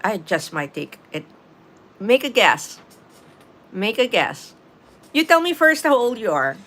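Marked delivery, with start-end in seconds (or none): none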